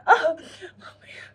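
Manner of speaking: slow, hammed-up sobbing; mock crying. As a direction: gasp it out like you're bawling. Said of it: "You're crying like".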